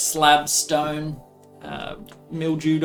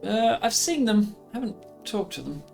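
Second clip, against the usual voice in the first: put-on tiny kid voice; 7 semitones higher than usual. GM voices Nori.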